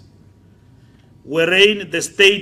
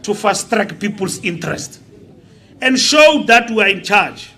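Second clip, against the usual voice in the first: loud, raised political oratory building to a shout; impassioned rally speech; 6 semitones higher, 10 dB louder than usual. Real, not acted. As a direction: drive it home to the crowd, getting louder and higher.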